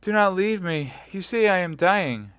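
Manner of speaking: high, loud desperate pleading; frightened cry; dying plea. Acted. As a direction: beg him not to leave. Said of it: "cried Madame Bonacieux".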